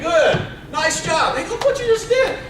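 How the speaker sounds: high, loud cheering praise; excited praise. Real, not acted. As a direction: cheer the crowd on. Praising audience after playing together.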